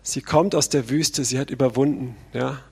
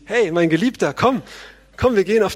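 bright eager call, higher and louder on komm; eager invitation; 5 semitones higher, 4 dB louder than usual. Acted. Bride eagerly calling her beloved to the fields, excited. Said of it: "Jetzt sagt sie".

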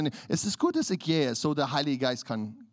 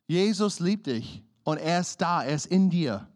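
ordinary delivery

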